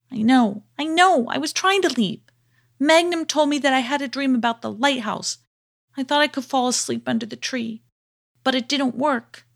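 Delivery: high, hurried little voice; pleading and flustered; anxious young hero voice. A narrator's reading. Abednego answers the bear.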